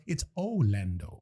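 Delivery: low, slow "Orlando" with no R; Orlando without R. Shown as a demonstration. Host corrects the theme-park reading, "Orlando" without R. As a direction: draw out "Orlando", dropping the R. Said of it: "the R out".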